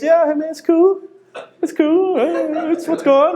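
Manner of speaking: high-pitched, forced casual small talk; awkward casual chat. Acted. Naked friend chatting to friend in the hot spring, awkwardly casual. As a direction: play it cool while trying not to look. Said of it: "try to carry a conversation without looking".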